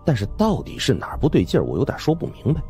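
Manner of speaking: ordinary talk, nothing marked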